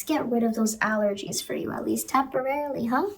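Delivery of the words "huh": ordinary talk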